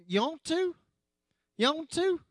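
high-pitched country "You want to?" run together as "Ya-ntu"; imitating the farmers' slurred "You want to?"; farmer drawl. A youth group guy mimicking church farmers, high country drawl. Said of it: "use certain words the way they used them".

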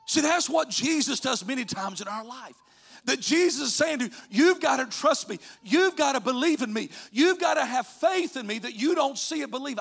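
high, loud, driving sermon delivery; full-force preaching. Real, not acted. Preacher urging the congregation to trust Jesus, high and forceful. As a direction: preach it out, punching each repeated appeal.

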